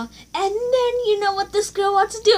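outraged disbelief at the classmate; indignant. Real, not acted. Complaining to viewers about the girl, high and loud.

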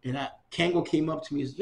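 normal talking voice

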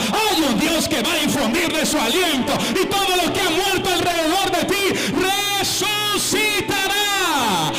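loud, rising sermon climax; preaching at full force. Acted. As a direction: build the church up to a shout. (predicación a todo pulmón, cada vez más alta)

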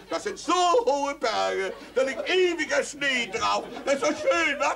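high, slow, loud rapture; father raving about Switzerland in a high loud voice; gushing father. Acted. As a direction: swoon over the snowy mountains.

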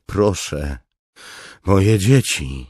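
high, loud, drawn-out plea; pleading cry. A narrator's reading. Captive begs the commander for his children, crying out. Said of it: "krzyknął Tanalan".

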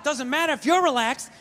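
ordinary delivery

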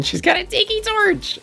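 high, sassy flip of a line; sassy drag queen. Acted. Sassy runway commentary.

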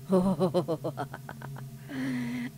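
wavering ghostly chuckle; mock-eerie stuttering laugh; spooky mock laugh. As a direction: pulse out a long, playful spooky laugh. Reacting to a spooky game scene.

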